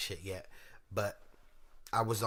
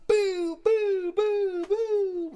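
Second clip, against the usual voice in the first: high, loud mock gunfire; loud gunshot sounds; 11 dB louder, much slower than usual. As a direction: bang out the shots. Mimicking gunshots.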